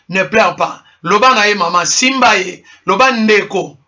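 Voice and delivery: ordinary talk, nothing marked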